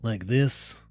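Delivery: slow, refined but gravelly; lightly voiced cultured crook; a hint of gravel, slow and refined; sophisticated gravelly voice. Shown as a demonstration. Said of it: "more sophisticated, but gravelly".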